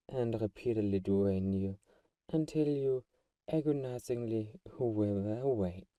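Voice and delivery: ordinary talk, nothing marked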